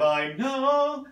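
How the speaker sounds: high I know with spin. Shown as a demonstration. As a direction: sing it, spinning to the end. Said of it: "got a little bit of spin on it".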